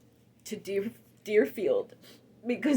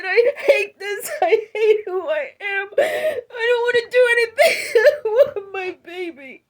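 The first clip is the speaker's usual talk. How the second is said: loud, high, tearful wail; crying out; 9 semitones higher, 9 dB louder than usual. Retold or imitated. Reliving her despair to the voice, wailing. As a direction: sob it out in despair. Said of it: "And I said".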